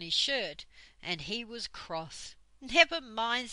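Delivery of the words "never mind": higher, louder, lightly amused; a touch of giggle, brightly consoling; giggly. A narrator's reading. Fanny teasing soaked, cross Joe, lightly giggly. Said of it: "said Fanny with a giggle".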